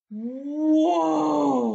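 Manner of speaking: long, loud swelling whoa; amazed. Acted. Storyteller sees what spinning made, drawn-out amazement. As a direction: stretch the word out in wonder.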